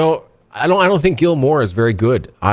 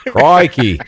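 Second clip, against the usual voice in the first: higher, louder Australian exclamation; mock Australian accent; 3 semitones higher, 5 dB louder than usual. Shown as a demonstration. Showing how a failed British accent turns Australian. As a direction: call it out in bad Australian. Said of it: "then you end up Australian".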